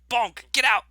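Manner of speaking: punchy mock telling-off, as to a dog; mock scolding. Player acts out the dwarf thumping her out, loud mock scolding. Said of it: "It's like".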